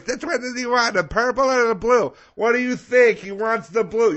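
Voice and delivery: high, loud back-and-forth squabble; imitated family bickering. Dad and daughter arguing over blankets, mock bickering.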